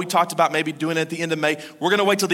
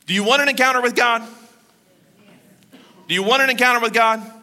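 higher, louder preacher's challenge; rousing call; 5 semitones higher, 4 dB louder than usual. Real, not acted. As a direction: call out to the room. Preacher calls to congregation.

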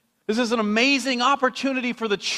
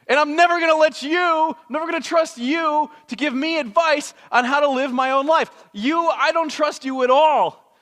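indignant rant; 8 semitones higher, 4 dB louder than usual. Retold or imitated. Friend scolding the reckless rider, indignant and loud. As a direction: tell them off, you'll never trust them.

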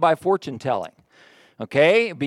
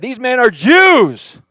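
slow, loud, higher sneering accusation; angry mob voice accusing the strangers; accusing crowd; 6 semitones higher, 9 dB louder, much slower than usual. Retold or imitated. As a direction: spit it out as a hostile accuser. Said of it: "here's what they said".

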